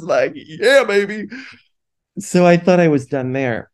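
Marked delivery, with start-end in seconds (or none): 0.3-1.3 high, hammed-up cheer; mock-triumphant and higher; jokey bravado. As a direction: crow it as a playful catchphrase. Jokey quote of himself showing off.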